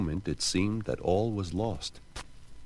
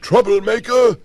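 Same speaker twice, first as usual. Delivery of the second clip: high, very loud angry shout; furious man yelling at the dripping witch; 11 dB louder than usual. Acted. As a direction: hurl it at the witch. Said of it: "he shouted".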